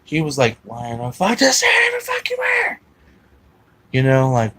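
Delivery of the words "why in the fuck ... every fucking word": very high, loud imitated scream; screaming dad. Son acting out his dad's fury over the sick dog, screaming. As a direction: erupt into a top-of-the-lungs screech. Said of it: "screaming top of his fucking lungs".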